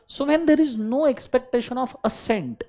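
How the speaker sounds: every word stretched for note-taking; slow dictation. Shown as a demonstration. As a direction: dictate it slowly. Teacher dictating.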